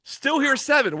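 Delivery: high and thrilled; excited. Real, not acted. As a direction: blurt it out with delight. Hyped about the announced match.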